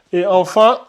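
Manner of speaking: normal talking voice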